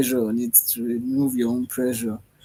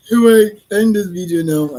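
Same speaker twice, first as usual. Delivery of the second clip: loud sigh; 8 semitones higher, 11 dB louder than usual. Real, not acted. Loud cry-like sigh on camera.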